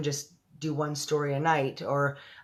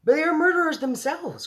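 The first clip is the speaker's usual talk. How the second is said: much higher, louder protest; cry out; 13 semitones higher, 5 dB louder than usual. Acted. The army head argues back to the Queen, high and loud. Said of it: "cried the head of the army".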